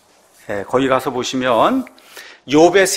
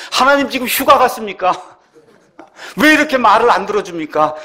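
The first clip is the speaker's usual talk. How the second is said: high-pitched, mildly exasperated protest; indignant complaint; 6 semitones higher than usual. Acted. Believer protesting to a silent God, indignant complaint. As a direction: demand an answer, voice lifted.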